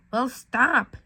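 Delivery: snapped. A narrator's reading. Girl snapping at the bullies to stop. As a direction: cut the bullies off hard. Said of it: "you snapped".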